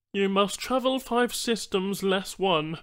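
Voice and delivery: high, odd-timbred alien voice; alien Banu trader. Acted.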